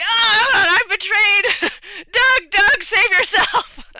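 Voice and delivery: very high, loud, slow hammed-up squeal; dying rat. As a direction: melodramatic death cry of a rat. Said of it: "the rat was like".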